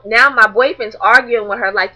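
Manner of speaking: ordinary delivery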